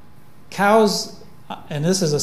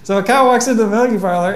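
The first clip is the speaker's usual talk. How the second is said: quick, higher joke-telling voice; joke teller; 6 semitones higher, 7 dB louder, faster than usual. Pretend. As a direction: tell it like a joke setup. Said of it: "one of those jokes".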